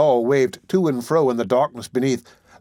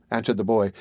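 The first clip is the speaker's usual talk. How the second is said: ordinary delivery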